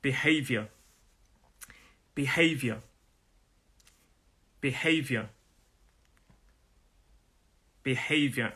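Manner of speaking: "Behavior" as bi-HAYV-yuh, falling; British "Behavior", evenly modelled with pauses; model pronunciation. Shown as a demonstration. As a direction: say "Behavior" carefully in isolation, no final r. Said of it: "go to the second word".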